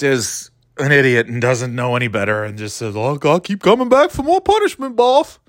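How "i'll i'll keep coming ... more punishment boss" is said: high put-on meek voice, much higher; mock doormat Gary. Mocking Gary to the audience in a high meek voice. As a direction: ham up a spineless yes-man.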